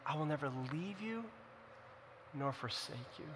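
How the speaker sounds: very soft, comforting promise; tender. Retold or imitated. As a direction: gently reassure someone who feels alone, in a hushed voice. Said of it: "the tender voice of the Lord coming".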